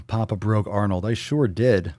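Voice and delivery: ordinary talk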